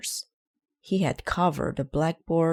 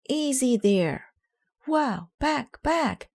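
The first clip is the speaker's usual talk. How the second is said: onlookers' voice; 10 semitones higher, slower than usual. A narrator's reading. Kids watching Arthur wobble.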